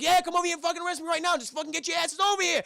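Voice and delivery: high, loud, fast angry rant; hammed-up enraged caller; furious mock shouting. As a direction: yell in exasperation at the operator. John yells at police operator.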